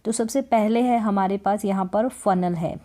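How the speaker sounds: usual speaking voice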